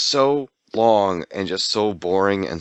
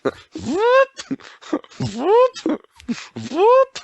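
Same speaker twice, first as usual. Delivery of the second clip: high siren whoop sound; alarm siren whoop; much slower than usual. Pretend. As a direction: wail like an alarm.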